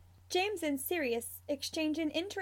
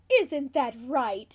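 put-on slow, louder baby talk; cooing; 8 dB louder, slower than usual. A narrator's reading. Sirius talks to the cub under the desk, cooing. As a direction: coo it to a little pet. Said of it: "he coos".

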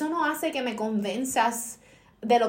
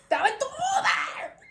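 very high mock-shriek, laugh breaking in; retold exasperated outburst. Telling him where the phone was, high mock shriek. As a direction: squeal it as an outraged punchline. Climax of retold angry call.

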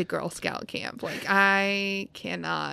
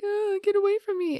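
much higher and faster, whiny; crying kid; 14 semitones higher, faster than usual. Retold or imitated. She voices the crying boy shoving the others away, high and whiny. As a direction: squeal it like a hurt little boy.

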